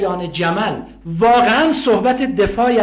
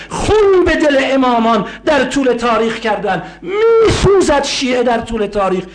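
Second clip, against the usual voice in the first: high, loud mournful preaching cry; full-force preaching; 7 semitones higher, 3 dB louder than usual. Acted.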